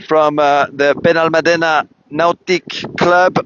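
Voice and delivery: normal talking voice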